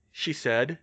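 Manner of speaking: usual speaking voice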